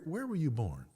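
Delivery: ordinary delivery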